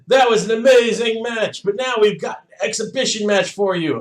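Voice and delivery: loud, high ring announcer. Retold or imitated. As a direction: hype the crowd like a ring announcer. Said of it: "the announcer comes up and he goes".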